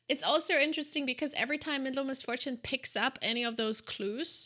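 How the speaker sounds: normal talking voice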